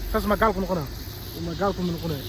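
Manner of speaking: ordinary talk, nothing marked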